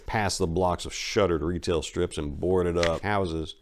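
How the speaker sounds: plain reading voice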